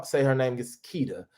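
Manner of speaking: ordinary talk, nothing marked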